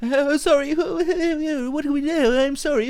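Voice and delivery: mock high-pitched, loud simpering apology; grovelling corporations. Spineless corporations apologising to their critics, high mock whimpering. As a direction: squeal a spineless, blubbering apology in falsetto. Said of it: "these corporations come out with their fucking".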